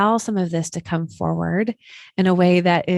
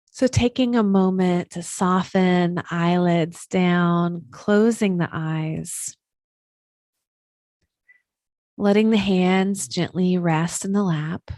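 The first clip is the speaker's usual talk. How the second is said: guided meditation. Acted. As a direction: settle them into stillness, unhurried and even.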